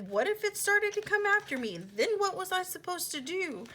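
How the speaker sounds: high, loud, worked-up retelling; panicked protest. Retold or imitated. Arguing with him about the snake coming after her, panicked protest.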